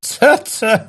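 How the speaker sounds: high-pitched tutting; playful, solemn disapproval; mock-serious. A narrator's reading. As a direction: tut with pretended gravity. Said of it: "mondta komolykodva Warden".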